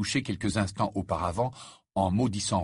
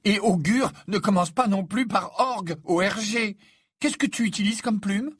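high-pitched, louder schoolgirl correction; exacting Hermione, correcting; 11 semitones higher, 4 dB louder than usual. A narrator's reading. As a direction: pick at a friend's spelling, pitched high. Hermione criticises Ron's spelling.

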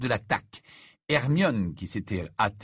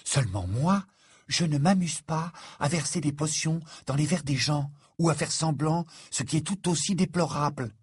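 coldly reproachful, pitched up; icy, higher-voiced reproach; 6 semitones higher than usual. A narrator's reading. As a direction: scold with cool disapproval. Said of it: "dit-elle avec froideur".

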